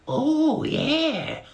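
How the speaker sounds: very high, loud squeaky beetle voice; high squeaky Bart. Acted. Dim little brother greeting Barry, high squeaky voice. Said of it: "said Bart".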